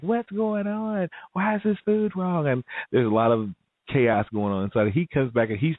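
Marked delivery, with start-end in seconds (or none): 0.0-2.5 frantic staff. Retold or imitated. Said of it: "they're like".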